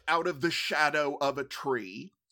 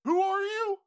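put-on old prospector; 13 semitones higher than usual. NPC confused.

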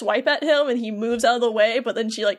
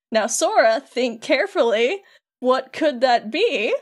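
slow, leading mock patience; goading; slower than usual. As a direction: draw out the question to prod him. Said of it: "like, goading him on".

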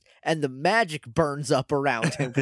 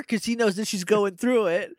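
ordinary talk, nothing marked

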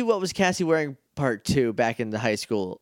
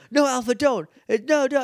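higher pleading no; desperate protest; 10 semitones higher than usual. Retold or imitated. Justin begging Alpha not to teleport, higher and urgent. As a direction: beg them to stop, pitched up. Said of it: "And just like".

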